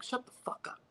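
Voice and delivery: breathy whisper of an angry order; unvoiced mock snap; hissed retold curse. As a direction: hiss it at the lineman walking away. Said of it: "And I'm like".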